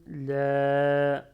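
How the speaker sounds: teacher lengthens La to show the alif to students; long La. Shown as a demonstration. En français : La allongé, lent et fort. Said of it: "suivi de Alif sans accent".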